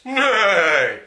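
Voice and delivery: loud, high whinnying "Nay!"; the horse Dodger whinnies in protest; horse neigh. Acted. Narrator voices the horse.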